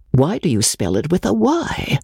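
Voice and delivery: ordinary delivery, nothing marked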